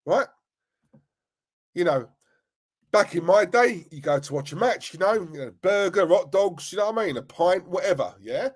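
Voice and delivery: usual speaking voice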